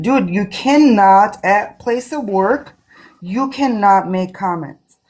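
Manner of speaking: slow and stressed on cannot, each cannot leaned on hard. Real, not acted. Telling Dave the workplace rule, stressing cannot. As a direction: drive home "cannot" slowly and with weight.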